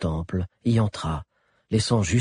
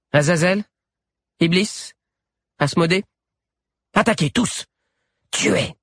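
high, raised command with rising names; commanding call; 9 semitones higher, 4 dB louder than usual. A narrator's reading. The wolf girl turns the caracals on their master, high commanding call. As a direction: call each beast, then order the attack.

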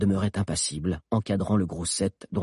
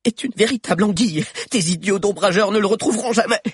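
high, loud, fast triumph; defiant taunt; 14 semitones higher, 7 dB louder, faster than usual. Acted. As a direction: fling the defiance. (bravade rapide, aiguë et forte)

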